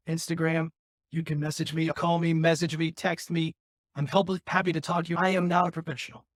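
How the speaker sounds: ordinary talk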